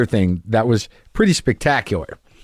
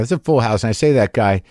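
ordinary talk

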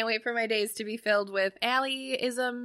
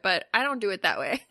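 usual speaking voice

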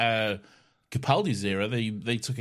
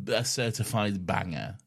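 normal talking voice

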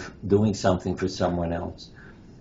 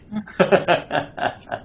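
amused laugh; 10 semitones higher than usual. Real, not acted. Delighted at memory of dying.

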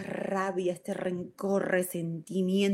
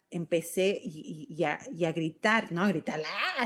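usual speaking voice

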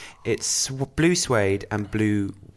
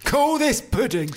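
mock shout; 4 dB louder than usual. Joins the mock voice.

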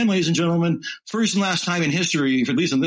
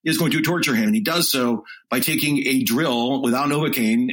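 ordinary delivery

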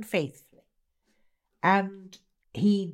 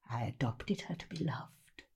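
fierce, softer whisper; 6 semitones lower, 12 dB quieter than usual. Acted. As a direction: hiss it as a dark confession. Whispered confession in reading.

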